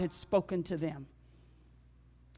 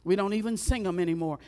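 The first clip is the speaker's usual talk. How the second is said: fast, pressed repeat; faster repeat; faster than usual. Real, not acted. Preacher laments to the church, said fast. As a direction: rush it again with emphasis.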